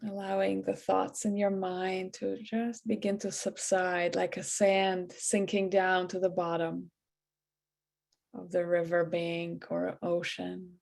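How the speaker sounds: soft, hushed meditation voice with long pauses; guided meditation. Acted. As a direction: ease them into relaxation, quietly and evenly. Leads a guided breathing meditation.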